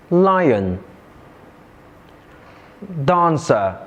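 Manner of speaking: "Lion, dancer" said LI-on, DAN-cer; "Lion, dancer", each falling away after a stressed first syllable. Shown as a demonstration. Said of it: "the other three nouns have stress on the first syllable".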